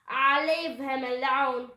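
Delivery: loud, slow grumble; drawn-out pirate grouse; crewman's growl. A narrator's reading. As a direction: growl it dismissively. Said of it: "Pinky growled at Jack".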